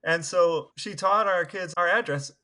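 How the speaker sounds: normal talking voice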